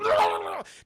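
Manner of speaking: mock being shocked over and over; mimicked electrocution noise. Streamer mimics Mel getting electrocuted, mock shock noise.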